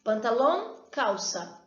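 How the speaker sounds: ordinary talk, nothing marked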